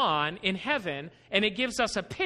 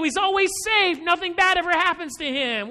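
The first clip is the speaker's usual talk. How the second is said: indignant complaining shout; 6 semitones higher, 5 dB louder than usual. Retold or imitated. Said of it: "And he's complaining".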